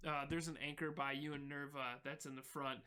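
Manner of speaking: ordinary talk, nothing marked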